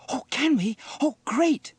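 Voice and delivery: high, loud, gasping delight; thrilled child. Acted. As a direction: squeal with eagerness at an invitation. Child thrilled at invitation to match.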